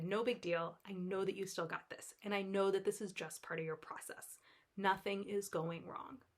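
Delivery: calm a scared part of yourself; soft soothing reassurance. Retold or imitated. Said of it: "and I said".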